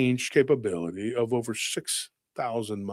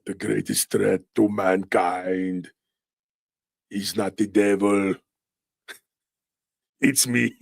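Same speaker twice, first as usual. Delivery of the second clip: slow, low, sinister villain impression; mock B B; 5 semitones lower, slower than usual. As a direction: drag it out like a devilish leader. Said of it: "there's the sulfur coming from the smoke".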